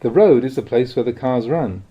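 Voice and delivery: ordinary delivery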